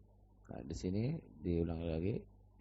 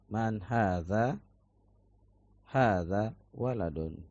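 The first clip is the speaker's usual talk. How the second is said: drill for repeating; 2 semitones higher, slower than usual. Shown as a demonstration. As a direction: say each phrase slowly and clearly for the class to echo. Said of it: "antum dengarkan baik-baik, kemudian antum ikuti ana".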